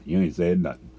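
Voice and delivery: ordinary delivery, nothing marked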